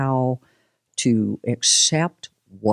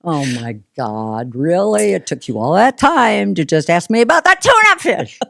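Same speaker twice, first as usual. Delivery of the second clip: louder, higher, fast mock exasperation; exasperated; 4 semitones higher, 5 dB louder than usual. Guest voicing her frustration about the tuna fish. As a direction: rattle it off in frustration. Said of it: "And it's like".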